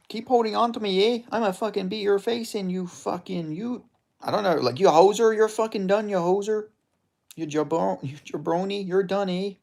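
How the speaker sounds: grumbling threats as a Canadian hoser; Albertan hoser. Acted. Gamer voicing his pinned fighter's threats, high Canadian accent. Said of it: "I'm doing that voice for this guy".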